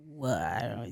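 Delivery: low, very soft disbelief; stunned hush. Retold or imitated. Reacting to a stranger claiming sisterhood, stunned and hushed. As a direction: drop to a small, low voice, reenacting shock.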